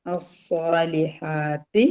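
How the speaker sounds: slow അസ്സാലിഹാത്തി with doubled s, no l; measured, chant-like അസ്സാലിഹാത്തി; joined recitation. Shown as a demonstration. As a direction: stretch the long vowels of അസ്സാലിഹാത്തി. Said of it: "ഇതും pronounce ചെയ്യല".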